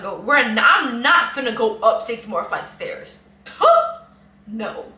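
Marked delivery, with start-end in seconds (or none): 3.4-4.0 short, loud, high-pitched scoff; sharp burst of incredulous dismissal; high scoffing "huh". Real, not acted. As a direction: scoff loudly, pitch shooting up. Scoffs at climbing more stairs.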